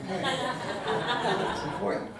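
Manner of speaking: high, slow, laughing through it; amused chuckle over the words. Real, not acted. Jokes about lacrosse coaching.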